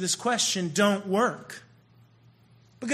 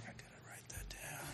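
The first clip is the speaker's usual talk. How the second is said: barely audible whispered note-to-self; hushed, under-the-breath whisper; furtive whisper; 25 dB quieter than usual. Acted. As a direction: whisper it as if secretly taking notes. Guys secretly noting the tips.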